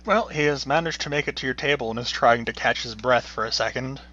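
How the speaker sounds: usual speaking voice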